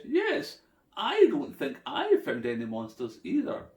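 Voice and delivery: high Nessie yes. A narrator's reading. Nessie agrees.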